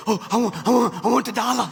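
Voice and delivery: mock greedy crowd, grabbing for a dollar; lightly voiced greedy crowd; greedy whine.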